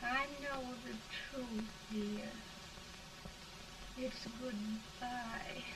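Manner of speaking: fading, resigned and weak; faint dying farewell. Acted. Dying woman says goodbye.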